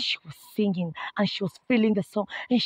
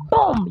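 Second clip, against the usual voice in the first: sudden higher "boom" for a blow; imitated slap; 6 semitones higher, 4 dB louder than usual. Storyteller mimics the director's slap, sudden imitated thud.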